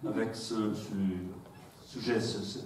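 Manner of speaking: halting French with an English accent; struggling through French as a non-native speaker; foreign accent in French. Real, not acted. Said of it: "mon français, c'est déplorable".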